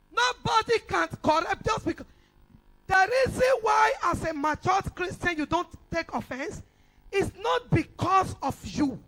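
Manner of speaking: shouted sermon rebuke; preaching shout. Real, not acted. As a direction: drive the rebuke home at full force.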